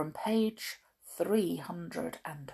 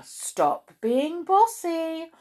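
slow, raised-pitch warning; stern; 11 semitones higher, 6 dB louder, slower than usual. A narrator's reading. Wilfred warning Miss Bossy, stern and slow. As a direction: spell out the telling-off slowly. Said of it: "sternly wagging his finger".

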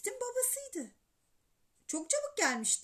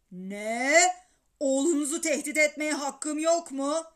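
loud, outraged phone quarrel; indignant arguing; 8 dB louder than usual. A narrator's reading. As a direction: argue heatedly with another father. Baba telefonda öbür babayla kavga ediyor. (telefonda öfkeyle tartışarak)

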